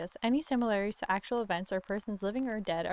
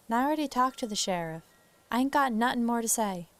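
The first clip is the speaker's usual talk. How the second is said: quick, put-on Southern lady; Southern farm wife shutting down questions; Southern accent; 3 semitones higher than usual. Wife brushing off the investigator, Southern accent. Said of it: "doing a Southern accent for a lady".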